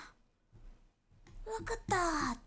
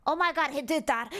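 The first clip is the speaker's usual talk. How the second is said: usual speaking voice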